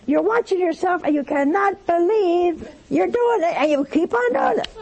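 high-pitched, mounting incredulity; exasperated disbelief. Shown as a demonstration. Watching yourself stay angry, exasperated disbelief. Reliving helpless disbelief at own anger.

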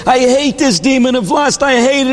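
high-pitched exasperated outburst; frantic complaint, pitched high; going crazy. Acted. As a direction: act out a man at his wits' end. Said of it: "the guy's going crazy".

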